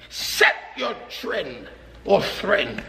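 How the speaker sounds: drawn out, each word weighed; slow it right down. Real, not acted. Preacher stretches out the charge to the congregation, very slow.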